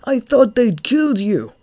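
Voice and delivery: high, loud exclamation; excited cry. A narrator's reading. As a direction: cry out to a companion feared dead. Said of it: "cried Throb".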